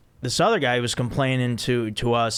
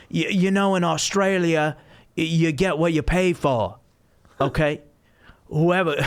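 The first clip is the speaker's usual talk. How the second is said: put-on raised, broad Australian accent with laughs; 4 semitones higher than usual. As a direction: play the complaining Aussie guy in an Australian accent. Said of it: "I'm not gonna try to do an Australian accent, but maybe I should".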